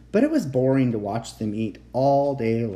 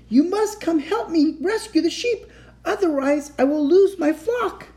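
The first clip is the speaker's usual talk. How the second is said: high, loud frantic plea; urgent pleading; 5 dB louder than usual. A narrator's reading. Shepherd boy begging the judge for help, high frantic pleading. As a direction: beg desperately in a high boyish voice.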